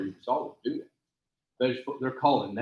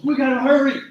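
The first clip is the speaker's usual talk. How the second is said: higher, louder hurry call; urgent shout; 10 semitones higher, 12 dB louder than usual. Retold or imitated. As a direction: hurry him up, loudly. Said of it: "He goes".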